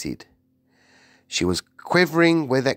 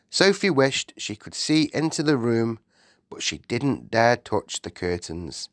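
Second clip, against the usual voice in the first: ordinary delivery, nothing marked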